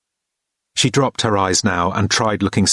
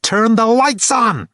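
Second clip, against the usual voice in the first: high, slowing command; angry order; 12 semitones higher, slower than usual. A narrator's reading. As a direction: bite off each word. Kate repeating order.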